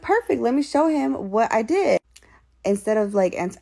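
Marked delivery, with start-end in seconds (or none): 0.0-2.0 high, upbeat and eager; pleased, pitched well up. Retold or imitated. Telling viewers she wanted to show him, eager and high. Acting out her eager reaction.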